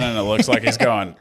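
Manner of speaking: ordinary talk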